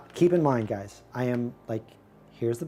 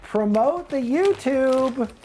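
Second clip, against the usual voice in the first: high, slow, singsong plea; excited call; 11 semitones higher, 3 dB louder, slower than usual. Acted. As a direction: hype the crowd, drawn out. Urging chat loudly.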